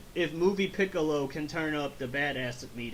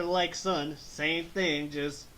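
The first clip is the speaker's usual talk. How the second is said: usual speaking voice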